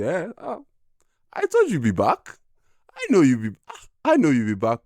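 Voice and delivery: put-on high, loud teasing father voice, with an African accent; a father's knowing "I told you so", pitched high; imitating his dad.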